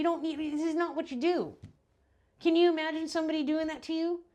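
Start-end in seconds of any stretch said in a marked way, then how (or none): 2.4-4.2 higher and louder, stress on you; rising outrage at the listener; indignant outburst. Real, not acted. Indignant rant building up.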